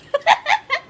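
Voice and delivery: high laugh; burst of laughter. Real, not acted. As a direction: laugh out loud. Cat bumps into ottoman.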